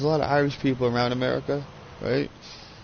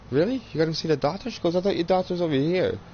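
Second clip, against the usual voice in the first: mother's teasing voice; 6 semitones higher, faster than usual. Retold or imitated.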